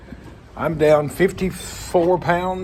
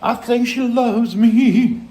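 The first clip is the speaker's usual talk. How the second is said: high, slow, louder mock voice; 10 semitones higher, 5 dB louder, slower than usual. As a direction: squeak it out slowly like a joke.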